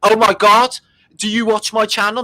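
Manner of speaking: high, excited imitating himself; mock excited. Host retelling his excited reply to the lady. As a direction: gush like a delighted fan. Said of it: "I said".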